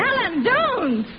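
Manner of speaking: frightened cry. Acted. He jumps from window.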